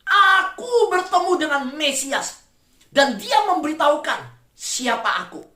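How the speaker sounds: high, loud, slow announcement; excited cry. Retold or imitated. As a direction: proclaim it to the whole village. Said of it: "Dia teriak". (teriakan gembira yang lambat dan keras)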